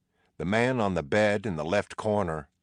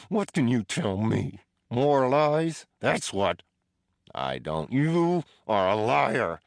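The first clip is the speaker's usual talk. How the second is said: higher, louder, slow; angry accusation; 6 semitones higher, 4 dB louder, slower than usual. A narrator's reading. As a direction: spit out the word liar. Prisoner accuses Teddy of lying.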